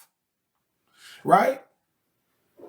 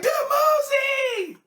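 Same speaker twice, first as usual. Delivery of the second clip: very high, slow, crying shout of the name; squealing the name even higher; much slower than usual. Retold or imitated. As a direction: shriek the name again, more thrilled and carried away. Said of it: "She was like".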